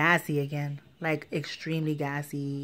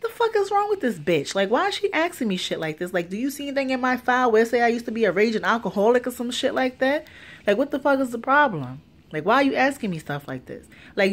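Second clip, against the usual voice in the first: much higher, louder, fast exasperated tirade; outraged rant; 7 semitones higher, 6 dB louder than usual. Retold or imitated. To the nurse asking about drinking again, high furious rant. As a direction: let the frustration explode. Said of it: "So I'm just like".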